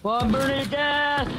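excited yell. Real, not acted. Gleeful call in game.

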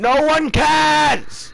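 high, long-drawn yell; slow, high-pitched bellow of annoyance; furious shout. Real, not acted. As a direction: shout down the announcer. Shouting back at game announcer.

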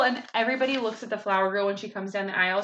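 ordinary delivery, nothing marked

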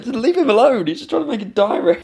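real laughing defence, pitched high; laughing protest. Real, not acted. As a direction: plead through laughter to leave him alone. Defending kid self, laughing.

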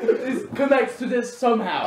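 high, loud, laughing theorist; mock conspiracy frenzy. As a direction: connect the clues in hammed-up excitement. Joins mock conspiracy theorist bit.

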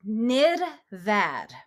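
Nirvad in two drawn-out syllables with a rolled R; slow practice demo. Shown as a demonstration. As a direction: model Nirvad slowly for students to repeat. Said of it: "practice saying Nirvad".

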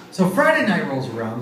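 normal talking voice

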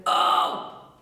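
toneless metal scream; dark noisy growl with no pitch; pitchless distorted "oh". Shown as a demonstration. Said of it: "the pitchless distortion kind of thing".

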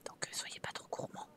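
conspiratorial whisper to the audience; whispered aside. Acted. Whispering a tip to the viewers, hushed. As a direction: breathe it out as a secret warning.

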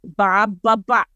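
"Ba, ba, ba" said as a settled answer; "Ba, ba, ba" as a calm reply. Shown as a demonstration. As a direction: answer yes like the lift man. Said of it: "with that inflection".

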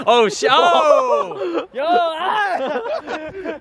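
high startled yelp; startled yell. Real, not acted. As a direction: yell as you slip. Slipping, yelling.

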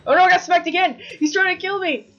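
high, loud alarm; panicked yelling. Real, not acted. Player being hit by the monster, panicked yell.

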